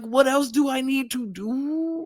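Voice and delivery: high-pitched imitated plea; pleading. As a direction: stretch the last "do" into a mock beg. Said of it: "Chandler was pleading".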